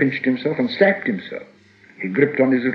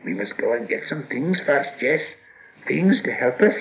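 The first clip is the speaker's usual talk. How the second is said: higher-pitched hissy creature voice; wheedling Gollum; 5 semitones higher than usual. A narrator's reading. Gollum stalling Bilbo to fetch things, hissy and wheedling. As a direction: stall slyly in a high hiss.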